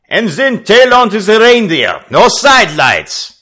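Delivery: high, loud sailor's shout. A narrator's reading. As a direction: bellow the order across the deck. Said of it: "the Frenchman shouted".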